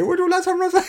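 high mock offer through laughter; laughing.

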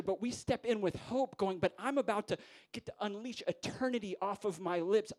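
high, driving sermon delivery; impassioned preaching. Real, not acted. Preacher rallying fellow preachers to stand in hope, impassioned preaching. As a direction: proclaim hope with rising fervour.

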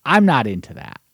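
normal talking voice

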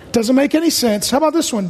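normal talking voice

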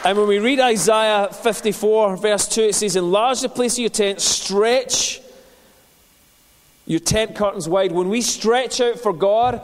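fervent pulpit preaching, slowed on key lines. Acted. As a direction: drive the message home to the congregation. Preaching to church.

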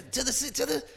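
very high-pitched urgent squeal; playful high-voiced mock alarm; mock frantic. Re-enacting excited script read-through.

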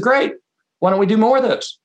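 high, eager "Great" jumping up; selling the idea with bright energy; excited great. Retold or imitated. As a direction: pounce on the good news with real enthusiasm. Excited reply to a good idea.

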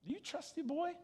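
put-on God as your boy. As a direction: let God hush him playfully. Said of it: "God looking at Ezekiel saying".

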